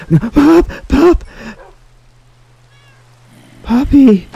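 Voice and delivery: high, loud distressed calling; upset cry. Real, not acted. Player calling off the puppy dog attacking the zombie villager, upset. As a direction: yelp out to the dog in alarm. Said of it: "I was a little bit upset".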